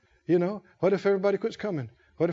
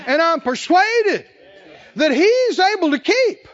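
loud, high, slow fervent preaching; preaching at full force; 7 semitones higher, 5 dB louder than usual. Acted. Preacher to congregation, declaring trust loudly and slowly. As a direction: build it like a revival climax.

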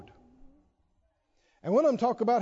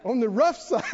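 breaking up into laughter; real laugh bubbling through the words; 3 semitones higher, 4 dB louder than usual. Real, not acted. Preacher tickled that some people know the phrase, laughing. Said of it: "I'm laughing 'cause some people know".

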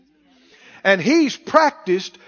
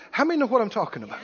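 ordinary talk

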